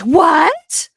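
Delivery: high, loud rising "what?"; a squealed, hammed-up reality-show gasp of a question; mock shock. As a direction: overplay the disbelief. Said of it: "Everything is a shock".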